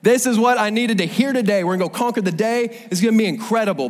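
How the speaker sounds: a Bible reader thrilled to reach this verse; excited cheer. Retold or imitated. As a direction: shout it like you just found hope. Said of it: "you're like".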